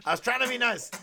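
high, loud and laughing; imitated aggrieved outburst; mock indignant protest. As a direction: protest like a wronged man while cracking up. Acted protest of roasted man.

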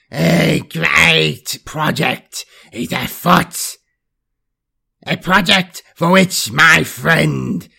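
very slow, booming demon voice; gigantic purring boom. A narrator's reading. Greater demon summoning its subjects, gigantic purring voice. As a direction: purr it out hugely, drawing every word long. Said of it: "a gigantic purring voice boomed down".

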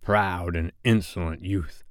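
slow, soft villain voice; measured, quiet menace; Hook. A narrator's reading. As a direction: pronounce it with grave disdain, slowly. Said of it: "said Hook".

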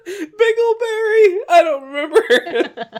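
very high, slow squeaky voice; hammed-up high confused answer; confused caller. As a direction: whine it high like a befuddled caller.

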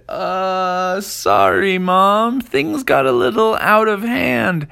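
put-on high, loud sheepish boy; a kid owning up to his mom. A narrator's reading. Apologizing to mom.

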